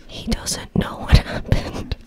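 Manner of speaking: breathy whisper. Acted. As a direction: say it under your breath. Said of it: "Try to be as natural as possible".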